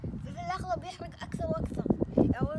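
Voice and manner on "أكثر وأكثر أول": excited shout. Real, not acted. Burst of shouting mid spicy challenge.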